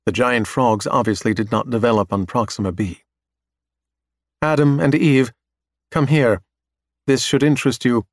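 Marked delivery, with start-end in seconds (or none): 4.4-8.1 calling out. Acted. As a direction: call them over from across the room. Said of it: "Marchenko calls out".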